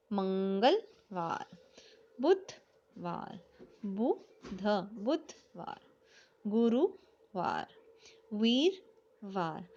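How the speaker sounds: each day name drawn out slowly for writing; slow dictation reading. Shown as a demonstration. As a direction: stretch every word slowly so they can write it. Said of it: "जैसे आप बोल रहे वैसे ही लिखना है". (लिखवाने के लिए हर नाम बहुत धीरे-धीरे खींचकर बोलें)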